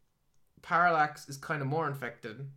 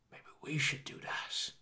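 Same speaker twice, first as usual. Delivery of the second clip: scheming whisper; 5 dB quieter than usual. Retold or imitated. Sinestro quoted scheming.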